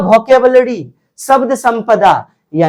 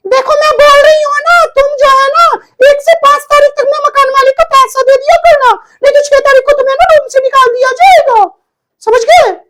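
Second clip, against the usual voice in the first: very high, fast scolding landlady; shrieking-high mock landlady telling tenant to pay rent; bossy landlady; faster than usual. Shown as a demonstration. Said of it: "देखिए क्या अभिनय".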